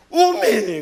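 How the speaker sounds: loud, startled Jeolla "오메". Retold or imitated. Pastor thrown forward in the braking bus, startled. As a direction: blurt it out in fright. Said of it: "뭐라고 했는지 아십니까".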